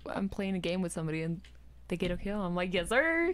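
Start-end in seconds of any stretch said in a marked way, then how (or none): 2.7-3.3 higher, louder proud yes sir. Shown as a demonstration. Gamer praising a teammate's kill, proud and higher. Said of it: "it's proud".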